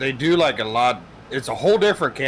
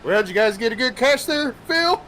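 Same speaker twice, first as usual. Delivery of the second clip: higher, louder mocking; teasing taunt; 7 semitones higher, 5 dB louder than usual. Jonathan taunting Phil over the radio, teasing. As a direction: needle him from the other boat. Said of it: "He goes".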